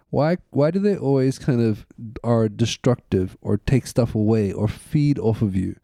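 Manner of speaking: ordinary talk, nothing marked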